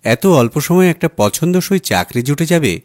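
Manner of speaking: usual speaking voice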